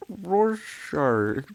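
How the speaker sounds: "Rorschach" said high and loud, ending on a rise. Shown as a demonstration. Streamer flustered about pronouncing a name, high loud try. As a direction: throw out "Rorschach" as a flustered guess. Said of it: "How do you not know how to pronounce it?".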